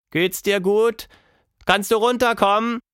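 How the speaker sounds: high, loud questions called upward; calling up. A narrator's reading. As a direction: call up to a friend in a tree. Child calls up into tree.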